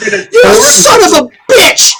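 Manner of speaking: high-pitched tearful outburst; angry accusation. Acted. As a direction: play a furious girl lashing out. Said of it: "she says, pointing at you".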